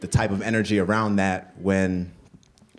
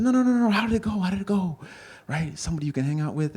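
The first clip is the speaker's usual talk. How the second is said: high, pushy mother voice that doesn't take no; insistent Ugandan mom; 12 semitones higher than usual. Retold or imitated. Mother refusing to let her son dodge, high insistent. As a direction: keep pressing for the story in her high voice. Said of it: "the Ugandan mom".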